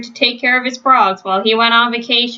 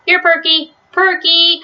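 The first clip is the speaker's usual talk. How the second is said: calling out; 8 semitones higher than usual. A narrator's reading. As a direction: call the lost dog by name. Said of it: "called Arthur".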